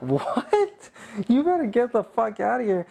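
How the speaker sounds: high-pitched mock indignation; incredulous comeback. Man retelling his quarrel with a dancer, mock indignant.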